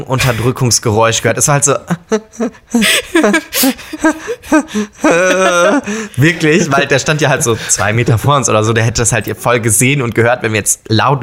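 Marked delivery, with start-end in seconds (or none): 2.7-6.1 high, squeaky suppressed laugh; stifled giggles. Real, not acted. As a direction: laugh you try to hold in. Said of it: "ich muss lachen".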